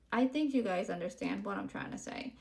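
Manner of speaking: usual speaking voice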